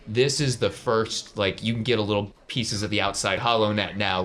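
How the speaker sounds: ordinary delivery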